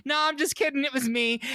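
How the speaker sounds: high, playful Bob voice; spring the joke in a lifted, teasing voice; high mock confession, giggly; teasing reveal. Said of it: "she's like".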